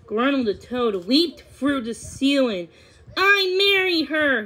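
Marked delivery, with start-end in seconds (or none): 3.2-4.4 high, loud toad's claim; insistent high-pitched shout. A narrator's reading. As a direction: shout it out over the crowd. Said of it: "he shouted".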